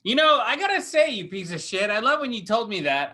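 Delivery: usual speaking voice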